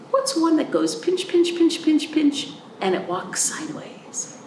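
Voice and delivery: high playful pinch, pinch; playful riddle. Acted. Teacher giving kids a crab clue, playful and high.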